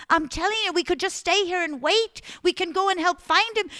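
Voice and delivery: high, loud urgent begging; urgent pleading. Acted. Margaret urging Simon to stay, high and loud. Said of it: "She begged him".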